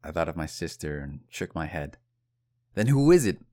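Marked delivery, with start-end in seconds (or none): 2.7-3.5 louder, higher demand as the impatient coach; raised voice. Retold or imitated. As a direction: raise your voice on the question. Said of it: "my coach raised his voice".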